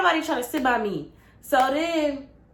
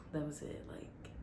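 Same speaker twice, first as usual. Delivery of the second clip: breathy whisper, curt; 6 semitones lower, 19 dB quieter than usual. Retold or imitated. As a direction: whisper it to end the talk. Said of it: "ending the talk".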